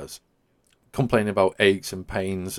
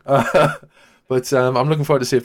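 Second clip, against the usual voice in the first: laughing; 5 semitones higher, 7 dB louder than usual. Real, not acted. Still laughing after the Italian accent.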